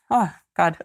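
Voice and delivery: choked-up little laugh breaking high; laughing through sudden tears; teary laugh. Real, not acted. Coach moved by her inner child prompts, teary laughing. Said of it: "I could even get teary".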